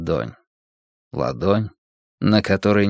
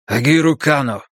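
скрипуче и жёстко; выдавить с каменным лицом; каменным голосом; 6 semitones higher, 4 dB louder than usual. A narrator's reading. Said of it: "проскрипел я каменным голосом". (скрипучий каменный голос)

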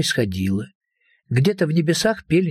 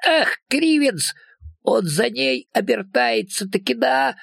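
much higher-pitched folksy creature; little creature voice; 11 semitones higher, slower than usual. A narrator's reading. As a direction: pipe up high from down in the grass. Unseen little voice in the grass. (высокий голосок сказочного человечка)

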